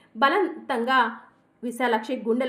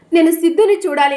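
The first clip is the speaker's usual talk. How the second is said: louder, higher cry to see her son; desperate loud plea; 4 semitones higher, 12 dB louder than usual. Acted. Breathless mother begs to see her son, loud and desperate. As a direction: beg to see him, straining.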